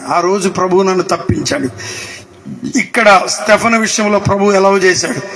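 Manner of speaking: ordinary delivery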